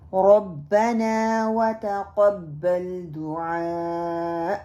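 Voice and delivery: ربنا وتقبل دعاء drawn out slowly; slow recitation. Shown as a demonstration. Teacher reciting the next dua before explaining mistakes. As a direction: recite it long and measured. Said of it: "اب یہاں پہ کیا ہم غلطی کرتے ہیں".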